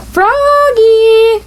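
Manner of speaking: high, drawn-out call from another room. A narrator's reading. Mother calls Froggy from downstairs, drawn-out call. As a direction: call him like a mother across the house. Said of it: "called his mother".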